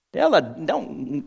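ordinary talk, nothing marked

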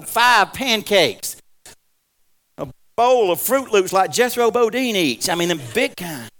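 loud, slow, savoring delight; loud, drawn-out craving for breakfast; food rapture. Real, not acted. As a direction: relish every dish out loud. Rhapsodizing over big breakfast.

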